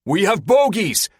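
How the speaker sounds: high, loud alarm call; yell. Acted. Pilot yells a warning over the comm, loud. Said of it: "Walker yells".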